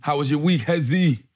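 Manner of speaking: put-on light African accent on Hezzy. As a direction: lilt the name in an African accent. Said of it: "Why are you doing that African accent at the end of it".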